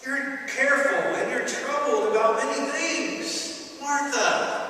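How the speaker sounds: Jesus gently urging Martha; sympathetic Jesus. Retold or imitated. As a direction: speak to Martha with empathy and urging. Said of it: "the sympathy in his voice".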